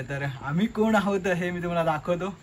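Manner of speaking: ordinary delivery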